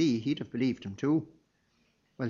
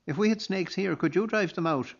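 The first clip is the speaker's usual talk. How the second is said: shout from crowd; 6 semitones higher than usual. A narrator's reading. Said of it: "shouted from the back".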